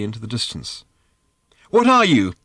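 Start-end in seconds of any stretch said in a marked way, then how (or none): 1.7-2.3 high, loud demand; shouted question. A narrator's reading. Girl shouting at the strange bird, high and loud. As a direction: shout it in alarmed bafflement. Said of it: "shouted Random".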